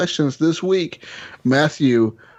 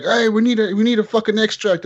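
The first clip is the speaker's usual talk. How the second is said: urgent call; 8 semitones higher than usual. Retold or imitated. Calling for extraction in game.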